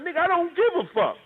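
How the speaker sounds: high, heated dismissal; snapping back at him; retold blowup. Retelling snapping at the guest, high heated outburst.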